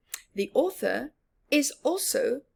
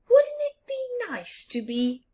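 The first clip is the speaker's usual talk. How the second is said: much higher wistful fox; 13 semitones higher than usual. Acted. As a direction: lift into a hopeful little fox. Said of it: "asked fox number one".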